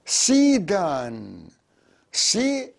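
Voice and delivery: stretched slowly, syllable by syllable. Shown as a demonstration. Teacher models سيدان slowly for students. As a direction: draw out سيدان for the learners. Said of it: "teacher demonstrates word slowly".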